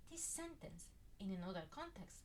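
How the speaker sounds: usual speaking voice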